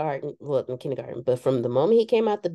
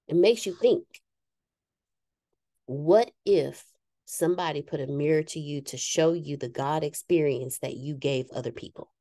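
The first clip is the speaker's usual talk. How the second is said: ordinary delivery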